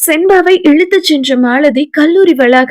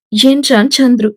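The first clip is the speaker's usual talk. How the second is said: usual speaking voice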